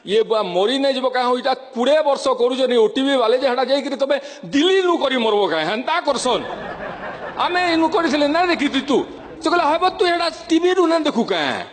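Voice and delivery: lively Sambalpuri dialect, rising high at the end; Sambalpuri accent. Retold or imitated. Villagers grumbling about TV, Sambalpuri accent. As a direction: chatter in broad western Odisha speech. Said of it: "western Odisha ଚାଲିଯିବେ".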